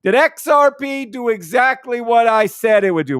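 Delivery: a hint of an Italian accent greeting Tony. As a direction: call out to Tony in a lightly put-on Italian accent. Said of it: "making an Italian voice for To".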